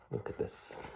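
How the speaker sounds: soft conspiratorial whisper. Real, not acted. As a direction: whisper it like a secret. Shows child a picture.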